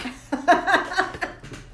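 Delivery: a voiceless, rising giggle at herself; high breathy giggle. Real, not acted. Laughing at herself crying.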